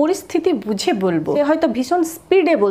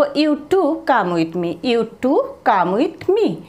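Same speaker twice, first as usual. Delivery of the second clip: very slow "You too come with me"; slow teaching read; much slower than usual. Shown as a demonstration. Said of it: "তো তখন বলবো". (ধীরে ধীরে "You too come with me")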